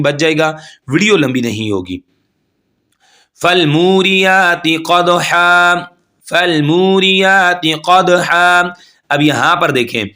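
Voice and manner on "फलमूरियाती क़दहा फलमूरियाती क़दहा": फलमूरियाती क़दहा drawn out slowly; chanted tajweed reading; slow recitation. Shown as a demonstration. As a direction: stretch मू, bounce the द. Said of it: "मू को खींचेंगे".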